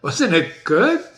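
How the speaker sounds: ordinary talk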